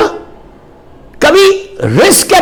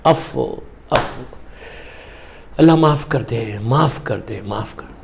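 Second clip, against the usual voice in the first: very low, soft plea fading away; hushed supplication; 10 dB quieter, slower than usual. Retold or imitated. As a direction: murmur a repeated prayer for pardon, imitating the maulana. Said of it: "مولانا تین مرتبہ بولتے". (دھیمی، مدھم ہوتی التجا)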